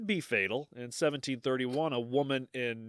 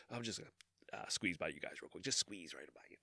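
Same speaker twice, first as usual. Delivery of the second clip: much softer, lower, meek Midwestern squeeze-by; Midwestern politeness; 5 semitones lower, 14 dB quieter, faster than usual. Retold or imitated. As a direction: tiptoe past strangers apologetically, very soft. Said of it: "that is a very Midwestern thing to do".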